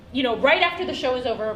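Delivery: normal talking voice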